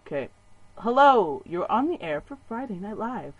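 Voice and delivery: ordinary delivery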